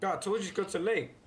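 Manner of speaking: loud, fast and high, fed up; exasperated shout. Real, not acted. Scolding the hiker over the radio, loud and exasperated. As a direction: snap it out at the lost hiker.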